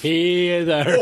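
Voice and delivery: drawn-out bad Scottish call; mock Scottish accent. Mimicking the actor's flashback call, mock Scottish accent. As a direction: stretch it in fake Scottish. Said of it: "flashback sequences with the Scottish accent".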